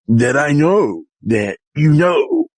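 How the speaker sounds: usual speaking voice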